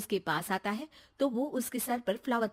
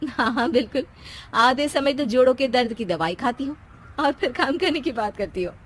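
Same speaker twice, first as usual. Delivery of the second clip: teasing; 3 semitones higher, 8 dB louder than usual. Acted. Narrator voices teasing reply.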